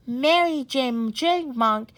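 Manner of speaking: ordinary delivery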